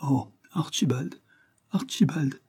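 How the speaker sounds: clinging to a husband in distress; trembling plea. A narrator's reading. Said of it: "l'enlaça de ses bras toute palpitante".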